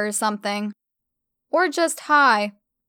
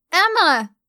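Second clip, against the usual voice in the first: high, loud shriek of a name; scream; 7 semitones higher, 5 dB louder than usual. A narrator's reading. Stella screams Emma's name to startle her. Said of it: "Stella screamed at me".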